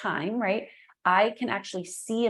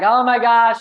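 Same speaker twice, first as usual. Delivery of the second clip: overwhelmed exclamation; 4 semitones higher, 15 dB louder than usual. Retold or imitated. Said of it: "I was like".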